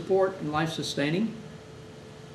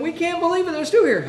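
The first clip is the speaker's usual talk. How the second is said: amazed family; 11 semitones higher, 3 dB louder than usual. Retold or imitated.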